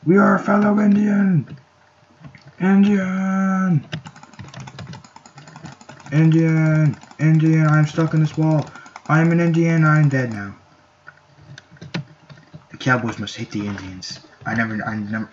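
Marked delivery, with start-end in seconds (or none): 0.0-10.5 high, loud, sustained chanting cries; mock war cry. Player yelling his team's name while stuck, mock war cry. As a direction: holler it on a high held note.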